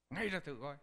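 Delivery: loud, high stern order; a master ordering a miracle; commanding shout. Acted. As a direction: bark the command to rise. Master commands the boy to straighten.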